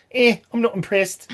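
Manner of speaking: put-on unimpressed doctor in an Australian accent; a shrugging Australian-accent put-down. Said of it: "doing the, uh, Australian accent there".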